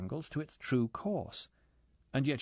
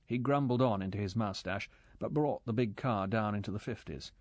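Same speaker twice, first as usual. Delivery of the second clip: usual speaking voice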